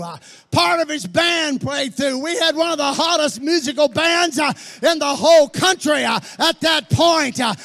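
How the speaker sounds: loud, high shouted sermon build; fiery preaching. Acted. Preacher shouting to his congregation, fiery and loud. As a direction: preach it to the rafters, pounding each phrase.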